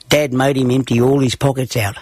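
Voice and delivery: plain reading voice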